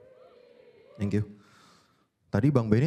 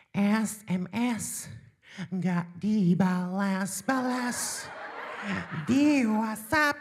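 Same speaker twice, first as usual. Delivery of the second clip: high, very slow whiny drawl; template voice; 7 semitones higher, 4 dB quieter, much slower than usual. Pretend. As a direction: whine it out slowly like a sulky child. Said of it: "suara template". (suara template yang tinggi dan sangat lambat)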